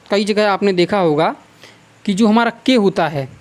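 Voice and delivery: ordinary talk, nothing marked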